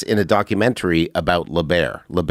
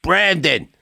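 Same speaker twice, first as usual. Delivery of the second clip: imitated high-pitched summons; calling out; 7 semitones higher than usual. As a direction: call a name in a high, drawn-out voice. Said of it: "and he's like".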